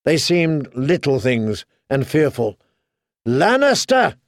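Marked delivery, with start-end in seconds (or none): 3.3-4.1 high battle shout, volume held back; high-pitched rallying yell, narrator's restraint; shouted war cry. A narrator's reading. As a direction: cry the house name mid-fight. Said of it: "he shouted".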